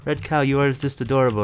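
normal talking voice

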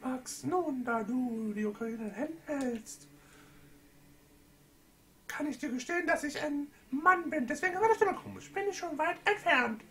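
squeaky high falsetto princess; mock high Zelda voice. Was ist mit meiner Stimme los. (hohe, gespielte Prinzessinnenstimme)

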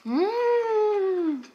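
high pleased mmm while chewing; savoring hum with mouth full. Real, not acted. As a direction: hum with delight over food. Tasting food, mouth full.

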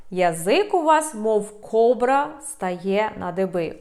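ordinary talk

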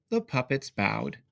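ordinary delivery, nothing marked

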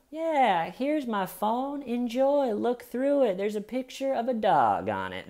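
high, airy sing-song mimicry; breezy clueless suspect. Mimicking clueless woman handing phone.

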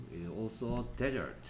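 very slow, dragged out; slow read-aloud. Shown as a demonstration. Teacher reading the desert line slowly to the class. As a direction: read it word by word. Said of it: "teacher reads sentence aloud".

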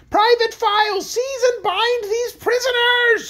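very high, loud screech of command; screamed order. A narrator's reading. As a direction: scream the order, stretching the end. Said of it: "screamed the Queen".

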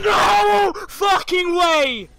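real, very high full-volume yell; long, piercing shout of disbelief; outraged shout. Real, not acted. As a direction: scream it out in disbelief, drawing out each word. Outraged reaction to losing in game.

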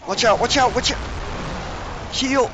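panicked warning. Real, not acted. Character in danger in game.

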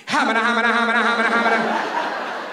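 rapid mumbo-jumbo patter on one note; mock auctioneer gibberish. Teasing a friend's father with his own babble, mock auctioneer gibberish. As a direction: rattle off nonsense like an auctioneer. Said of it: "was an auctioneer for".

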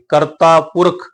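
ਕਰਤਾ ਪੁਰਖ slow, in one phrase; joined reading. Shown as a demonstration. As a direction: link ਕਰਤਾ ਪੁਰਖ, holding the long taa. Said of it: "ਇਹ ਦੋਨੋਂ ਸ਼ਬਦ ਇਕੱਠੇ ਪੜਾਂਗੇ". (ਕਰਤਾ ਪੁਰਖ ਇਕੱਠੇ, ਹੌਲੀ)

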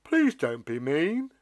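slow, shy giant pleading; a lonely giant asking the stalk; bashful. Acted. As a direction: plead gently, shyly. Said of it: "he said bashfully".

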